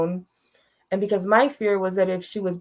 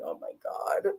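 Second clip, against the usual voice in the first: much higher breathy whisper of disbelief; exasperated whisper; 13 semitones higher, 10 dB quieter than usual. Retold or imitated. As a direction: whisper it, stunned and fed up. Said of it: "I'm like".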